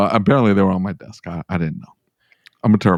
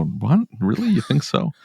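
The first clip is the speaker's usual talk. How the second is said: rising, sarcastic disbelief; mock surprise; 7 semitones higher, slower than usual. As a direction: play dumb with a lift. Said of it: "And I go".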